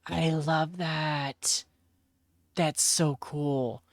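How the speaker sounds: slow, gasping delight. Real, not acted. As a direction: savour it with a gasp. Gasping delight at pink marines.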